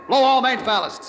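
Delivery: high, slow and loud command; firm urgent order. Acted. Captain ordering the ballasts blown, loud and firm. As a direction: call it so the whole boat hears.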